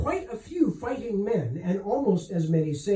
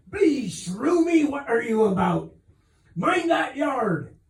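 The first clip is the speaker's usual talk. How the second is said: angry shout; 7 semitones higher, 3 dB louder than usual. A narrator's reading. Captain shouting at sailors about the yard, angry and loud. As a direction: rage at the careless sailor. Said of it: "he suddenly shouted".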